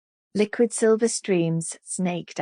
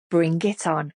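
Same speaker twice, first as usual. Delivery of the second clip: ordinary delivery, nothing marked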